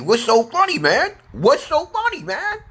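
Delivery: very high squeaky playful challenge; comic falsetto protest; mock indignation. Mock challenge to a laughing commenter.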